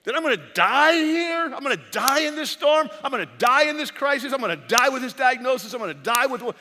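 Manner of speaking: high, loud desperate shout; yelling at God. Retold or imitated. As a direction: cry out to God that you're about to drown. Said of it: "wanted to yell at God".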